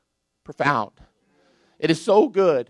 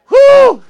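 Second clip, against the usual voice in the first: high joyful whoop, much louder; joyful whoo; 16 dB louder than usual. Real, not acted. Preacher whooping over his own joy, joyful shout.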